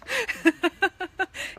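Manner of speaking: bright laugh rising high; a burst of real laughter. Real, not acted. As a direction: laugh it off at the memory. Laughing at Maine neighbours.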